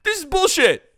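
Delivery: put-on loud, squeaky kid voice; outraged kid. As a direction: play a little boy crying out to his dad in disbelief. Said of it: "Little Timmy can't believe it".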